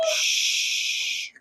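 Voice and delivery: unvoiced hush to quiet the room; whispered shushing sound; soft shush. A narrator's reading. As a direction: breathe out a gentle shh. Shushing the crying children.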